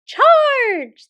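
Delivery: very high, very loud squeaky order; squeaking battle command; mouse general's command. Acted. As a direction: bark the charge like a tiny general. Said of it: "the mouse general commanded".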